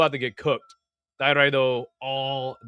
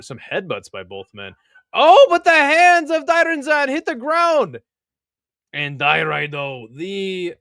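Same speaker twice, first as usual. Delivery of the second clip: mock sumo commentator calling the bout; play-by-play call; 3 semitones higher, 4 dB louder than usual. Streamer calling the sumo bout for chat, play-by-play. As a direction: narrate the clash like a live announcer.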